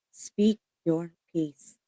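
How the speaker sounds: slow, drawn-out urging. Real, not acted. Channelled message in the reading.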